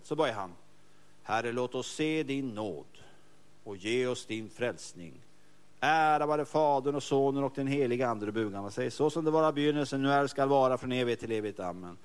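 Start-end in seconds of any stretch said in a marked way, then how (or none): 1.2-5.2 slow, hushed liturgical chant; slow reverent prayer voice; prayer recitation. Shown as a demonstration. 5.8-12.0 intoned liturgy; liturgical recitation. Shown as a demonstration.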